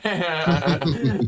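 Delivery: high, loud laugh bursting out; burst of laughter. Real, not acted. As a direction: let a real laugh burst out.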